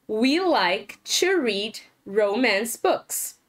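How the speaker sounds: slow, careful classroom English; slow model sentence. Shown as a demonstration. Teacher modelling for students, slow and careful. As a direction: spell it out slowly for learners.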